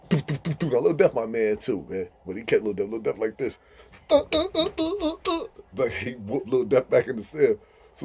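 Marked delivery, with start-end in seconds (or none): 4.1-5.4 high yelping grunts; high hurt grunts while being beaten; imitated beating grunts. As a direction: yelp on every blow.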